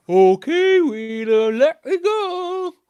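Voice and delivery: high, loud, drawn-out begging; frantic plea. A narrator's reading. Stuck cow begging Wheelam the tree, high and frantic. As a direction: cry out to be let go.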